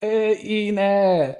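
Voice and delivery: high, loud, drawn-out delight; slow, stretched exclamations bursting with enthusiasm; imitated excitement. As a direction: imitate him thrilled for you. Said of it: "he got so excited".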